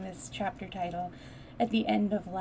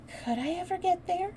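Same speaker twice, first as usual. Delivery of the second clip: wistful; 12 semitones higher, 5 dB louder than usual. Acted. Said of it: "asked Mary wistfully".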